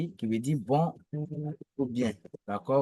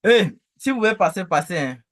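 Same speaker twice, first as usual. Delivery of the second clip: calling out; 5 semitones higher, 7 dB louder, faster than usual. Real, not acted. Passers-by enter the video.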